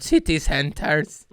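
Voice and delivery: slow, drawn-out smug "City centers."; mock-smug slow repeat. Shown as a demonstration. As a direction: stretch out "City centers." like a know-it-all. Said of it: "I am so smart".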